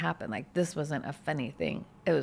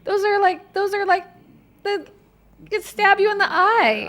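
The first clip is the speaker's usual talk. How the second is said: high, loud, worked-up outburst; alarmed exclamation; 9 dB louder than usual. Real, not acted. To a friend about something that could stab you, an alarmed high outburst. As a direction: blurt it out in alarm. Alarmed reaction to a dangerous object.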